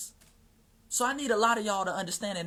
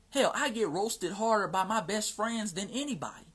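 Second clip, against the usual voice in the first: ordinary delivery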